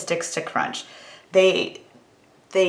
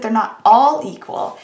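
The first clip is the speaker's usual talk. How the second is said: "all" raised and punched out of the phrase; stress on "all"; 2 semitones higher, 6 dB louder than usual. Real, not acted. Stats teacher restating the complement, stress on "all". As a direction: lean hard on the word "all". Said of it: "I wanna emphasize the word all".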